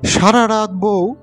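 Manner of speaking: ordinary delivery, nothing marked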